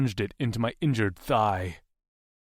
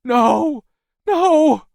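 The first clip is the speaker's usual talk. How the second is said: much higher, louder scream of no; screaming girl; 13 dB louder than usual. Acted. Maisie screaming as the knife cuts him, loud scream.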